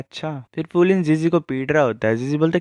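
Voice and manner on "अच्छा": a soft, menacing "अच्छा" through an angry smile; captain quietly warning his gossiping friend with an angry smile. A narrator's reading. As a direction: say "अच्छा" quietly, smiling with barely held anger. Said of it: "गुस्से वाली smile करता है".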